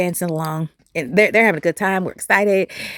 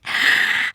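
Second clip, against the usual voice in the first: sharp gasp. Retold or imitated. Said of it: "I was like".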